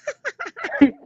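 loud high burst of laughter. Real, not acted. Cracking up at the twang joke, loud laugh. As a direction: crack up hard.

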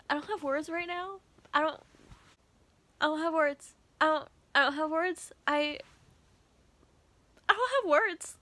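high, insistent repeats; upset, lost for words. Real, not acted. Reader with no words after a book, upset. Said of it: "I am upset".

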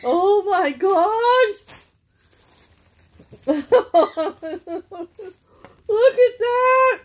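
high, loud squeal of delight; thrilled squeal. Real, not acted. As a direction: burst out with real delight. Opening a gift bag, thrilled.

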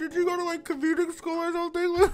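high, loud squeals through pretend laughter; over-the-top cracking up at a joke; hammed-up laughing hard. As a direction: act helpless with laughter, voice way up high. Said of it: "I'm laughing mad hard".

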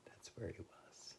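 hushed whispered aside; barely audible, soft reveal. Real, not acted. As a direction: whisper it to the listeners. Hushed aside to the young listeners.

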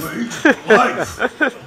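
slow, drawn-out tough guy voice; hammed-up meathead; mock tough guy. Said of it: "Just like".

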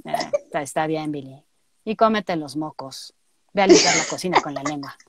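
low, flat and unimpressed; deadpan low as gloomy Mandy. Acted. Resigned actress giving Mandy's gross line, low and flat.

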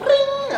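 high, loud ringing noise; imitated school bell. Narrator sounding the bell for class, imitated ringing.

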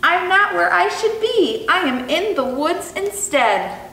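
lightly voiced tiny turtle. A narrator's reading. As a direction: speak up small like a lost little turtle. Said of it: "with his little tiny voice".

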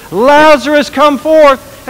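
calling out loud and high to the dead man. Acted. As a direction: shout the name across the tomb. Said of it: "Jesus called out in a loud voice".

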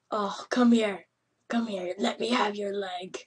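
usual speaking voice

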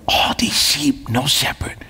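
whispered, near tears; hushed. Real, not acted. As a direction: breathe it out, moved by the lost. Preacher moved by the lost.

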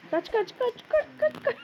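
high panicked run run run; panicked yelling. Real, not acted. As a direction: yell at yourself to flee, climbing. Fleeing monsters, yells run.

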